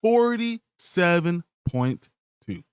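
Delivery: very slow, each word dragged out; slow disbelief. Real, not acted. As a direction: let the number sink in slowly. Repeats the grade, slowly, in disbelief.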